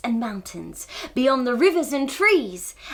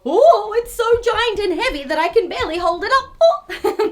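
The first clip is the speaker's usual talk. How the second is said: higher, effortful mock heaving; pretend straining; 6 semitones higher, 5 dB louder than usual. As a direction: act as if lifting something very heavy. Pretends to lift a giant icy pole.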